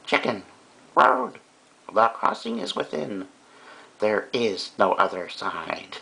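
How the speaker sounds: high, halting mock-mystical recital, slowing to the end; mock-profound poem. Teacher reads Savage Chickens cartoon to students, mock-profound.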